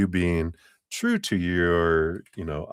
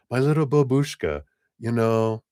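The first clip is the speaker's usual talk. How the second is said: put-on higher-pitched granny with a Russian accent; 5 semitones higher than usual. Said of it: "talking with a, like, a Russian accent".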